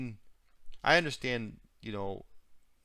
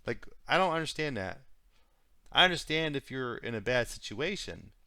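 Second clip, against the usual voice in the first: ordinary talk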